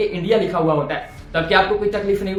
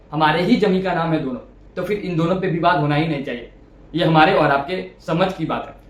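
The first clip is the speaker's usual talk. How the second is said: usual speaking voice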